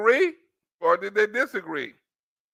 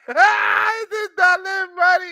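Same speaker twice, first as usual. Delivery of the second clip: high, drawn-out mock wail; hammed-up outburst; 9 semitones higher, 4 dB louder, slower than usual. Reactor joking about Don Lemon getting money, hammed-up outburst. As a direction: cry it out high and slow, in jest.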